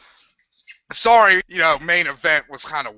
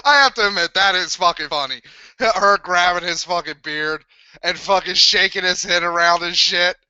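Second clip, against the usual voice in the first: usual speaking voice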